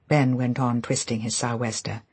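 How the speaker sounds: ordinary delivery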